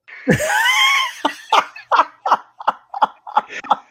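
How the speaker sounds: high, helpless cackle of laughter; loud wheezing laugh. Real, not acted. Storyteller cracking up at his own prank, high helpless laugh. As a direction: burst out laughing, can't stop.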